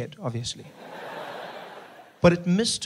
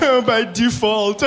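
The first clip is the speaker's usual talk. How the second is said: laughing; 6 semitones higher, 6 dB louder, much slower than usual. Retold or imitated. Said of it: "I was like".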